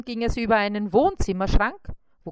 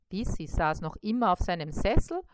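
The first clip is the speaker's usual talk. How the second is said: ordinary delivery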